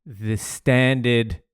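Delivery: usual speaking voice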